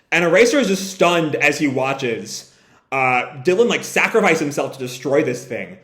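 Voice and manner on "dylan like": usual speaking voice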